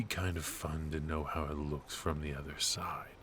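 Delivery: hushed curious whisper; secretive whisper; confiding whisper. Acted. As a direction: lean in and whisper the admission.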